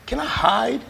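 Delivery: pleading question. Retold or imitated. Retold plea to God.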